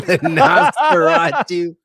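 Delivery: laughing out the name. Real, not acted. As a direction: say Nosferatu through laughter.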